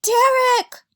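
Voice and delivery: very high shrill call across the cave; yelled. Acted. Mom calls her husband, yelling. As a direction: shout for him from far off. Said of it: "Mom yelled".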